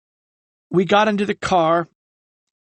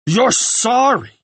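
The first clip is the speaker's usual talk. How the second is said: slow, high, furious outburst; exploding in rage; 7 semitones higher, slower than usual. A narrator's reading. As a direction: throw the apology back at him. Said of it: "Ryan exploded".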